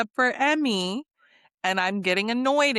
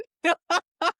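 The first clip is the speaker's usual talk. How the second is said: high burst of real laughter; laughing; 9 semitones higher, 4 dB louder than usual. Real, not acted. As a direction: crack up mid-story. Laughing about Mia laughing.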